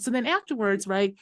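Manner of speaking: ordinary talk, nothing marked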